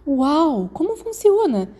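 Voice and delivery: high, eager wide-eyed wonder; excited. A narrator's reading. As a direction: burst out with curiosity, pitch jumping up. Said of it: "quando ela estava animada".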